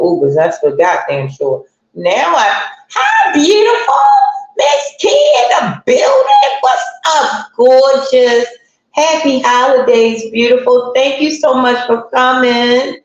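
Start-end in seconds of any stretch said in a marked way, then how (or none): 2.9-6.6 high, bright shout-out to a viewer; high excited hello to a viewer; excited greeting. Real, not acted. Greeting viewers on live stream. 6.6-13.0 cheerful greeting. Real, not acted. Greeting viewers on live stream.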